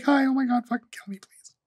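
high cheery hello dropping to a whisper; bright to whisper. Retold or imitated. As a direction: chirp hi then whisper a plea. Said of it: "She's like".

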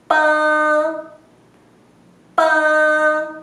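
pa with pressed lips released. Shown as a demonstration. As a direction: close the lips and pop out pa. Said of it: "enfocar en los labios".

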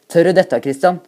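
shouted question, loud and high; calling out. A narrator's reading. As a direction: call out a challenge to a mate. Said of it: "roper Jagge".

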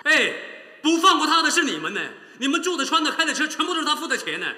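man hitting back at the sister in a heated row; angry retort. Acted. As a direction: throw it back at her. He fires back in the quarrel.

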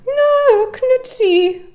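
high cooing squeal. Real, not acted. Gamer cooing at the baby on screen, high squeal.

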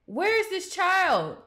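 slow, loud, high alarmed call; alarmed shout. Real, not acted. Gamer finding the baby gone, shouting.

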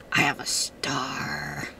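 low, slow whispered pirate; hushed low pirate voice. Pretend. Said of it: "I'm a pirate".

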